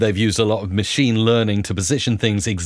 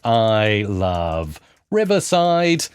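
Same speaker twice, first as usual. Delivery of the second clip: drawn-out, slow dictation; typing along; 3 semitones lower, much slower than usual. Real, not acted. Presenter typing sample text into the voice tool, slowly. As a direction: stretch each word as if typing it.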